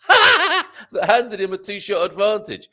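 loud, high laugh spilling into the words; real cackle, voice left high and amused; laughing while reading. Real, not acted. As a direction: burst out laughing, then push on with the sentence. Laughs mid-sentence while reading.

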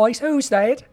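high, put-on Scottish attempt; Scottish accent. Co-host tries the stranger's line himself, high mock Scottish accent. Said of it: "supposed to be a Scottish accent".

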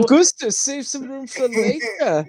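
mock German accent, slowing at the end. Co-host joins the accent bit, mock German. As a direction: join in with a playful German accent.

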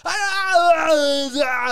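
high, loud mock whine; squeaky cornered whimper. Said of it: "And he's like".

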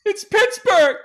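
high, loud, hoarse party yell; rowdy Uber driver. Retold or imitated. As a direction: bellow it like a drunk Pittsburgh local ready to party. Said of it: "That's what he says".

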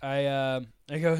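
ordinary talk

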